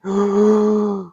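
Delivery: loud, high sharp intake of breath; deep inhale. Shown as a demonstration. Coach demonstrating the inhale, loud sharp gasp. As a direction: suck the air in deep. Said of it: "fill up your stomach".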